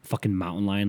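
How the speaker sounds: ordinary talk, nothing marked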